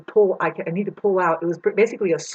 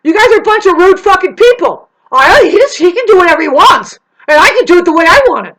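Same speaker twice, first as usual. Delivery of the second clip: much higher, loud indignant rant; hammed-up furious outburst; imitating angry man; 9 semitones higher, 12 dB louder than usual. The man yelling at her as rude, a loud high mock rant. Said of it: "this guy is saying".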